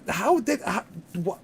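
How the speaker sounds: normal talking voice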